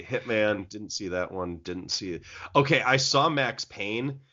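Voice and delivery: normal talking voice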